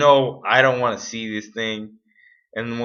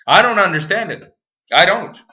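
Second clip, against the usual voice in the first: frustrated outburst; 7 semitones higher, 6 dB louder than usual. Real, not acted.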